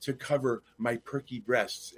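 soft, slowing, lightly played sitcom seductress; aggravated underneath, keep the smile and savor each phrase; hushed, leisurely put-on sweetness; Blanche. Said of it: "but keeps smiling".

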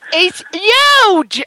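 high, punched Jersey "huge" without the H; Jersey-style exclamation; Jersey accent. Acted. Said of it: "the Jersey accent".

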